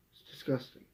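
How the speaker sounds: a hushed, revolted aside; whispered disgust. Real, not acted. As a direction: whisper it with disgust, under the breath. Repeated line, now whispered.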